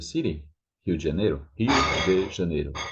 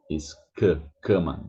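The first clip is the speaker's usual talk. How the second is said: normal talking voice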